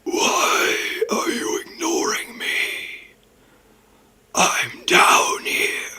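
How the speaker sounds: slow, high, crying and breathy shout; dark sorcerer. Shown as a demonstration. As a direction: wail it slowly like a lost spirit. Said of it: "I have a idea for what happens with your voice here".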